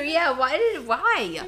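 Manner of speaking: ordinary talk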